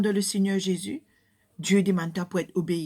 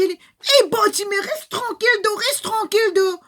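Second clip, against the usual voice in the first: high, loud scolding; crowd hushing him; 11 semitones higher, 5 dB louder than usual. Acted. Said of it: "la foule".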